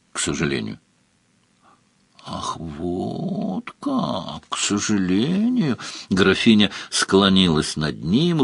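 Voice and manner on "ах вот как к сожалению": long drawn-out, teasing repeat; sly mock echo. A narrator's reading. Countess throws Svarog's words back, slyly teasing. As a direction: stretch it slowly with a sly smile. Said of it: "лукаво улыбаясь".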